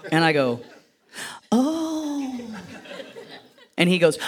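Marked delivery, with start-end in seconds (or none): 1.5-2.4 high guilty little oh; busted teen's nervous oh; sheepish caught oh. Retold or imitated. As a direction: squeak it out, caught red-handed. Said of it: "in a mocking way".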